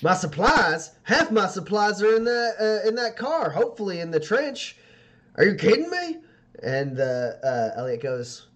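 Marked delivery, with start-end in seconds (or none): 0.0-6.2 high, loud indignant outburst; bristling shopkeeper voice. Acted. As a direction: protest in disbelief. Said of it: "Duke kind of bristles".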